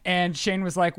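ordinary delivery

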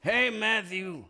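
high, slow drawn-out greeting; Garrett Morris impression. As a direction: stretch out a high hey. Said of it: "it's my Garrett Morris impression".

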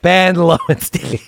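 stretched-out confident reply, played for laughs; confident answer. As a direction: imitate a patient utterly sure of himself. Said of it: "But he very confident".

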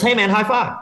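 high, goofy party-dude voice; drunk young guy. Retold or imitated. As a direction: bro asking for a high five. Drunk kid approaching at party.

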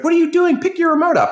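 high, loud mock scream; imitating screaming kid. Kid screaming at his teammate mid-battle, mock high yell. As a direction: shriek it like a kid losing a game. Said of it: "he's like".

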